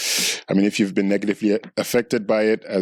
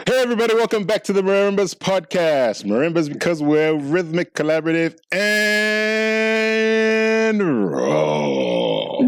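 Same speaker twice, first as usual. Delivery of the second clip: podcast host intro; 9 semitones higher than usual. Acted.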